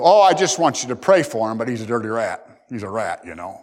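sweet request sliding into a low sneer; mock pious gossip. Preacher mocks a gossip asking prayer, low sneer. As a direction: fake concern turning catty.

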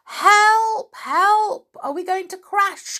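high, loud acted cries for help; frightened cry. A narrator's reading. Children in falling boat swings, frightened cry. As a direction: cry out in storybook panic. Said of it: "cried the children in fright".

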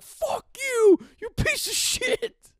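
high, loud outburst of abuse; hammed-up furious yelling; mock rage. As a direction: scream insults in exaggerated fury.

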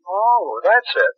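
ordinary talk, nothing marked

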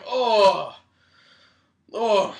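hammed-up moaning from a cramp; mock pained groan. Cramped runner stops running, groaning in mock pain.

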